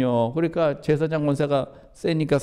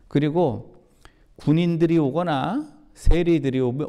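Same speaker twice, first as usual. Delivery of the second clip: ordinary delivery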